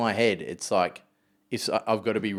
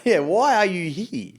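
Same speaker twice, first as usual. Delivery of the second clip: higher, slow drawn-out question; mock startled dad; 9 semitones higher, 4 dB louder, slower than usual. Dad finding kids in room, a high slow disbelieving question. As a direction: stretch it out in disbelief.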